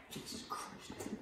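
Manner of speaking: exasperated whisper under the breath; whispered curse. Acted. Chef cursing his messy helper, whispered. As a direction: mutter it to yourself in despair.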